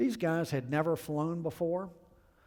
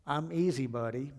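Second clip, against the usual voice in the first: lazy, mock-friendly Southern accent; fake Southern accent. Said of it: "sort of a fake Southern accent".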